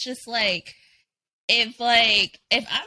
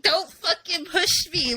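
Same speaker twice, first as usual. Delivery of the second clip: laughing mock threat; 6 semitones higher, slower than usual. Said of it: "You're like".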